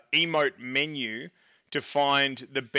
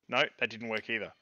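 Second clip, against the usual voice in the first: usual speaking voice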